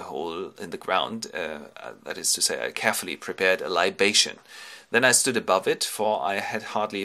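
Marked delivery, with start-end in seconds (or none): none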